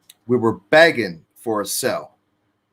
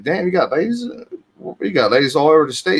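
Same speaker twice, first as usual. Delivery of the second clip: higher, fast, impressed mock surprise; amazed disbelief; 5 semitones higher, faster than usual. Narrator reacting to the guard's many ladies, amazed. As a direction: react like you can't believe it. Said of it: "I was like".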